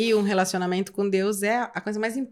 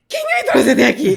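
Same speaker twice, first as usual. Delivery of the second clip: loud, higher cry of disbelief with laughter; mock bewilderment; 5 semitones higher, 8 dB louder than usual. Girl coming off the stage, loudly bewildered. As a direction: act out a startled "how did I get here". Acts her shock after leaving stage.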